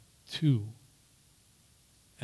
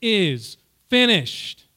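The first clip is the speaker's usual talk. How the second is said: loud, high, slow proclamation; crying out loudly; 6 semitones higher, 6 dB louder, slower than usual. Retold or imitated. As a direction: cry out each word separately and forcefully. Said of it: "He cried out in a loud voice".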